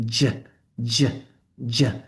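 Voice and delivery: j with the voice box on, repeated; j sound. Shown as a demonstration. Teacher contrasting voiced j with ch. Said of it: "turn on your voice box".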